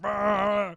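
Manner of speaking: drawn-out mock cheer; imitated French fans' roar. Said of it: "All the French are like".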